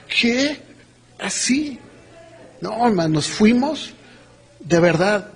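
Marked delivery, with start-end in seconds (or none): 0.1-1.8 higher, hushed disbelieving questions; acted amazement. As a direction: act out stunned surprise. Acting out amazement at the offer. (asombro fingido, agudo y en voz baja)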